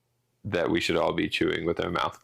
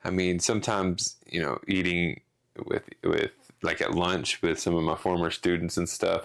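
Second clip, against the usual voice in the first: ordinary talk, nothing marked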